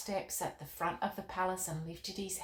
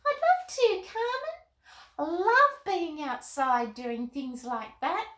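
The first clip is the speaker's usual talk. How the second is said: put-on the puppet Molly talking to the children, bubbly; 10 semitones higher, 6 dB louder than usual. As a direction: play the cheerful little girl answering. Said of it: "So would you help me".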